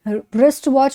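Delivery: "रिस्ट वॉच" with no W sound at the start; silent W. Shown as a demonstration. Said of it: "रिस्ट वॉच बोलते हैं".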